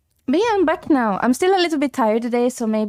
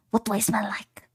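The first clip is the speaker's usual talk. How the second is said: breathy secret whisper; 3 semitones lower, 6 dB quieter than usual. Real, not acted. Streamer whispering to chat about her scent. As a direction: hush it like a secret.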